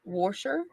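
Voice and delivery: "Washer" with an added r, like "Warsher"; "Washer" as "Worsher", questioning rise; regional pronunciation. Shown as a demonstration. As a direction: put an r before the sh in "Washer". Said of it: "I'm like, What?".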